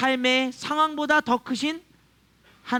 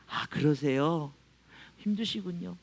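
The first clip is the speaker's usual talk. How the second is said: softer and slow, lowered, soothing; gentle sympathy; 6 semitones lower, 6 dB quieter than usual. Retold or imitated. Pastor calming an angry drunk man, slow, soft and sympathetic. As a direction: settle an upset man with a soft voice.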